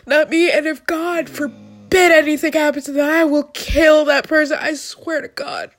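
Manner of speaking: real emotional outburst; fierce vow. Real, not acted. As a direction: swear it with everything you have. Vowing to protect future kids.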